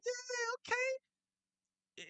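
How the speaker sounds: high, thin, disbelieving "yeah, okay"; squeaky sceptical mock reaction; sarcastic yeah okay. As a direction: play along like you don't buy a word of it. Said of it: "you like".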